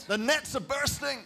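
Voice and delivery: high-pitched amazement; excited storytelling. A narrator's reading. Preacher describing the straining nets to church, excited storytelling. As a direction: exclaim it high, mid-action. Acting out the miraculous catch.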